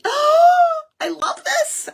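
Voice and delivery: high, loud squeal of delight; squealing with joy, imitated; retold delighted shriek. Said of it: "I shrieked".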